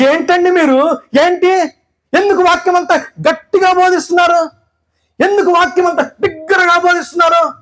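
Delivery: wife nagging the pastor about his shouting, high; scolding pastor's wife. Retold or imitated. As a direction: play the fussing wife scolding her husband. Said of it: "అని చెప్పి అడిగింది".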